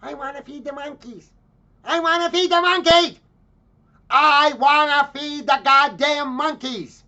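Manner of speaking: put-on high, loud spoiled-kid screech, escalating; mock four-year-old shrieking at her parents; screaming child tantrum. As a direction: build from a high whine into a full-blast bratty scream. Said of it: "who immediately starts screaming at her parents".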